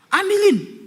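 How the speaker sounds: high, loud order; sharp command. Retold or imitated. Wife ordering possessed husband to fetch, loud high. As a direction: snap the order at him.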